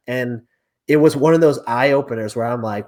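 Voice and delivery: usual speaking voice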